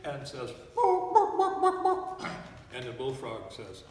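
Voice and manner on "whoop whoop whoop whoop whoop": high, loud hen whoop; squawking chicken. Acted. Chicken calls to the bullfrog.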